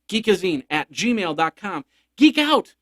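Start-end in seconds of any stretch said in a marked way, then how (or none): 2.2-2.7 excited shout. Real, not acted.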